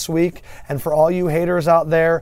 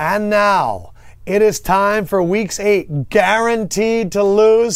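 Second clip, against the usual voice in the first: segment intro; 4 semitones higher, 3 dB louder, slower than usual. Acted.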